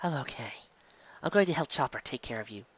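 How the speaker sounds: Robin, quiet and gentle; hushed calm nurse; soft reassurance. Acted. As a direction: soothe him softly. Robin voiced softly.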